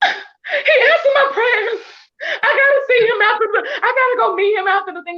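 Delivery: high, tearful, laughing cry of joy; near-tearful excited squeal; overjoyed outburst. Retold or imitated. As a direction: relive the moment your prayer was answered. Reliving joy on meeting him.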